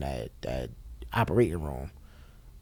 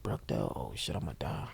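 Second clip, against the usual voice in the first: hushed dread; 7 semitones lower, 11 dB quieter than usual. Retold or imitated. Hearing the bad hospital named.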